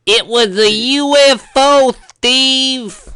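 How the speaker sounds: high, loud put-on character voice. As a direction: squeal it out high and drawn-out. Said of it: "do the voice".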